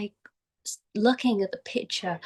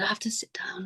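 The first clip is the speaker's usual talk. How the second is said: softer, breathless whispered plea; weak whisper; 9 dB quieter than usual. Retold or imitated. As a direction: imitate a sick old man barely able to speak. Ailing elder stops on the walk.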